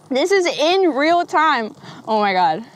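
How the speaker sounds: usual speaking voice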